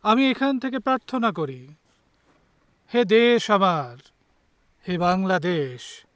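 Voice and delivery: slow, projected declamation with long pauses; impassioned Bengali poetry reading, swelling on key lines; poem recitation. Acted. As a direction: declaim each phrase as verse, loud and measured, letting line endings hang. Recites own poem on Etna.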